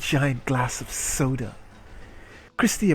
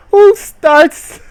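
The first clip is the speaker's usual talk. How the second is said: much higher and louder, disbelieving; incredulous squeal; 14 dB louder than usual. Real, not acted. Streamer mocking the heroine's opening line, high incredulous squeal. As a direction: shriek in disbelief at the book.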